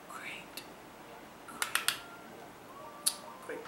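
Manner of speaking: whispered sarcastic great. Real, not acted. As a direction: breathe it, deadpan.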